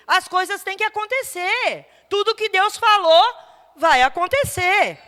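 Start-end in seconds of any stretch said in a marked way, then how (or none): none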